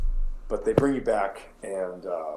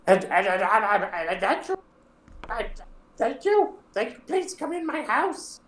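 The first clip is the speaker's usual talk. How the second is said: put-on much higher, loud, strange old woman cry; terrible hag voice; 11 semitones higher, 3 dB louder than usual. Gnarled mother calling to her returning kids, high terrible voice. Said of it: "their voices are terrible".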